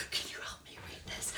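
urgent whisper; mock whispered plea for help. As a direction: whisper it to your friend. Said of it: "now I'm like".

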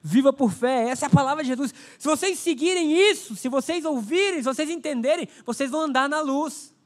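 rising, fervent preacher's call; preaching. Acted. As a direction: preach it out with growing fire. Preaching at full force.

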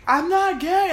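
high, loud mock-tearful denial; retold crying protest. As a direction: wail the denial, replaying an argument. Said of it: "I'm, like, crying".

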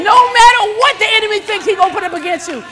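high, loud, driving exhortation; full-force preaching. Real, not acted. Preacher rousing the saints to trust God, fervent.

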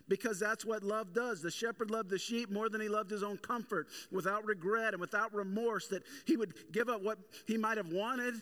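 preaching at full force. Acted. Pastor building to the climax of his sermon, impassioned. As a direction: lift the room with the joy of the Father.

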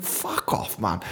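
soft breathy whispered curse under the breath; whispered aside. Retold or imitated. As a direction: hiss it so only your friend hears. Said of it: "And you're like".

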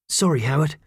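ordinary delivery, nothing marked

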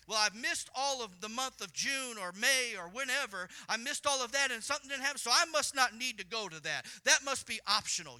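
loud, breezy self-justifying voice; proud excuse-making. Acted. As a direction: shrug it off as if it doesn't matter. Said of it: "pride would say".

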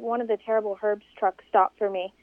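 ordinary delivery

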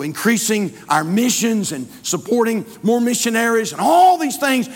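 high, loud building list; mounting, loud exasperated-style listing; questioning congregant. Acted. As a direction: pile up the list, climbing in pitch. Voices congregant listing church's efforts.

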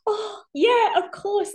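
high, sheepish of course; sheepish realisation. Retold or imitated. Mum answering her daughter, sheepish and high. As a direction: admit it with a sheepish lift.

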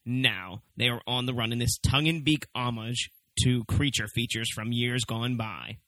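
low, dramatic trailer narration; deep movie trailer announcer; horror trailer voice. Acted.

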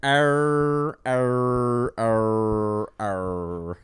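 long loud "arr" groans stepping downward; Snape stubbing his toe. Acted. A wizard who hit his toe, loud drawn-out descending groans. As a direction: moan it like a dying dog. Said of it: "that's what I think Snape is".

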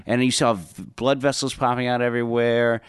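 ordinary talk, nothing marked

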